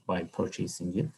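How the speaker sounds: normal talking voice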